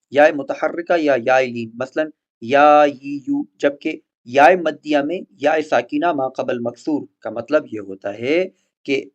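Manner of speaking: ordinary talk, nothing marked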